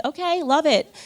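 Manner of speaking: breezy, high-pitched okay; nonchalant. Retold or imitated. Wife agreeing to eat only bread, nonchalant. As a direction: agree airily, as if it were nothing. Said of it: "I said nonchalant".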